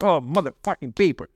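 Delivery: grumbled swearing in mild disgust; resentful. Acted. Recycling while hating it, a mildly resentful curse. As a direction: curse it with weary hate. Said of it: "I go with that attitude".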